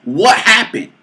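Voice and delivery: usual speaking voice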